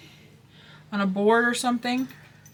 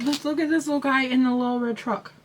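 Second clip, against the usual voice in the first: ordinary talk, nothing marked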